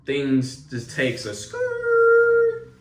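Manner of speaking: very high, very slow sing-song stretch; dramatic high drawn-out. Acted. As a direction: stretch it way up for the big twist. Dramatic turn in the story.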